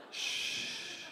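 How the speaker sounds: a whispered shh to the crowd; hushing. Real, not acted. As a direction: hush the room with a breathy shh.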